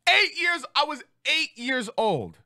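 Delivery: high-pitched disbelief, drawn out; incredulous outrage. Real, not acted. Comic protesting police threats against a child, incredulous.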